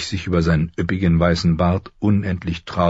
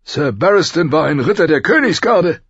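much higher, loud protesting king; complaining king; 11 semitones higher, 5 dB louder than usual. Acted. Robert defends himself. (beschwert sich, laut und hoch)

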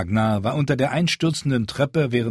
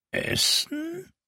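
very high, rasping bird voice, softer; croaking raven; 4 dB quieter than usual. Acted. The raven murmurs to the crowd, high and croaking. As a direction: squawk the word like a talking raven. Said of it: "murmelte der Rabe".

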